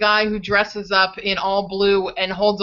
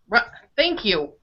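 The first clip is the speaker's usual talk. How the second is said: ordinary talk, nothing marked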